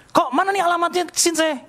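very high, urgent retold question; impatient demand. Man messaging a friend for the healer's address, impatient. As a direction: press impatiently for an answer. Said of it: "nggak sabar".

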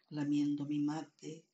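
ordinary talk

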